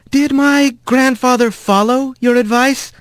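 gentle careful attorney; young mouse lawyer. A narrator's reading.

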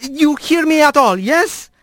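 high, careful calling voice; loud and clear. Acted. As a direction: call out slowly and clearly. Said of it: "as loudly and clearly as he could".

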